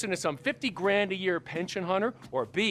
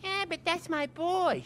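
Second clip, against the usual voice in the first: high, whiny mock imitation; mocking mimicry; 11 semitones higher than usual. Businessman mimicking the other man's objection, mocking. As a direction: parrot him back in a much higher voice.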